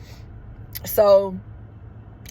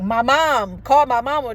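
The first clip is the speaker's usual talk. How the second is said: re-enacted begging, raised and louder; urgent plea for mom; 4 semitones higher, 6 dB louder than usual. Patient begging staff to call her mother, acted urgent plea. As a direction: call out for your mama, mock-desperate. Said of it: "This is how I'm saying it".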